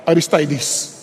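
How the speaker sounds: "Aristides" with the third syllable as "tie"; "tie" pronunciation. Shown as a demonstration. Preacher settling on a pronunciation, "Aristides" with "tie". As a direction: settle on a-ris-TIE-dees for "Aristides", low and soft. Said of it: "iba-iba yung pronunciation".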